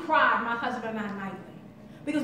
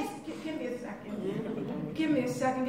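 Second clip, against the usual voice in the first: shaken and overwhelmed; 4 semitones lower, 9 dB quieter than usual. Retold or imitated. A mother asking gang members for a moment, shaken and much softer. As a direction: catch your breath and stall, voice much softer. Hand shaking, sweating, overwhelmed.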